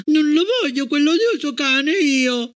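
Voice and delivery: put-on high, tearful child's protest; a crying girl refusing. A narrator's reading. Gloria refusing the new dog, tearful. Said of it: "con le lacrime agli occhi".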